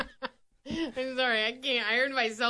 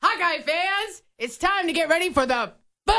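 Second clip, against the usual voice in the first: explosive announcer; 2 semitones higher than usual. Pretend. Radio host on the last try at the stadium call, exploding the boom. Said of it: "Really explode".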